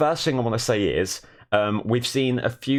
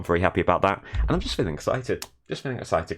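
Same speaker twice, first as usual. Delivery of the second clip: ordinary talk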